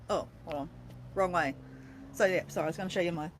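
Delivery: ordinary talk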